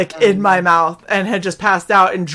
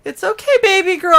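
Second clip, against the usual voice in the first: high, gentle soothing father; calm her down like a dad carrying his girl; 8 semitones higher than usual. Retold or imitated.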